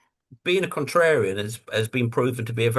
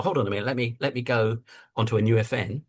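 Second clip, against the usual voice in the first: normal talking voice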